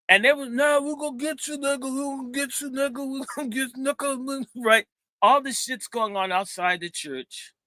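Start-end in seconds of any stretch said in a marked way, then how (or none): 0.5-4.5 high, repeated taunting threat; gang threat. Retold or imitated. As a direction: taunt the cornered man over and over.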